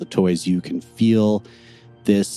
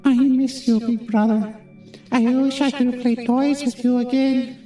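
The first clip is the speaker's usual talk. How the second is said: tiny ghostly voice. Acted. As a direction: drift it in like a lost little ghost. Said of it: "tiny, ghostly voice on the wind".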